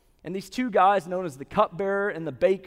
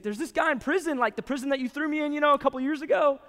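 higher, quick and eager; excited telling; 7 semitones higher, faster than usual. Retold or imitated. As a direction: rush the news out to the king. Cupbearer eagerly explains to Pharaoh.

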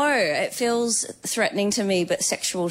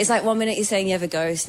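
ordinary talk, nothing marked

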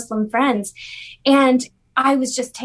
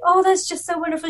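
mock gushing; 6 semitones higher, faster than usual. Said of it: "We're like".

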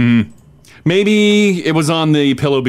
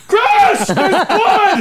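laughing mock alarm; much slower than usual. Mimics blood dripping into mouth.

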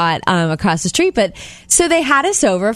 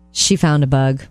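low, unruffled husband; deadpan calm; 7 semitones lower than usual. Retold or imitated. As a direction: say it calmly, pitched down. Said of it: "Like, that calm".

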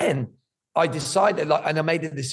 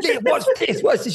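much higher, laughing, eager "watch this"; excited urging; 11 semitones higher, 4 dB louder than usual. Retold or imitated. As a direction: laugh while urging her to look. Urging sleepy wife to watch.

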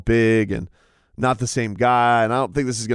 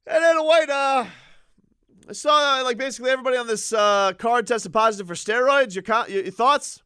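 higher, hurried mock reporter question; 10 semitones higher than usual. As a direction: rattle off the question in a squeaky reporter voice. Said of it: "you're like".

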